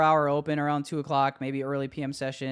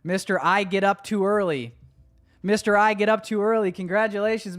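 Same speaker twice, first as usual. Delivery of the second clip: excited announcement; 6 semitones higher, 6 dB louder than usual. Acted. Announcing the winner.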